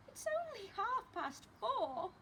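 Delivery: put-on high grumbling child; protesting child Lester. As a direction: complain like a boy sent to bed early. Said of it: "said Lester".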